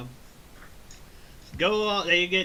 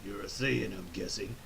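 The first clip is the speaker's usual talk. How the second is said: shady Paragus; 4 semitones lower, 6 dB quieter than usual. Retold or imitated. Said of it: "this dude Paragus is like".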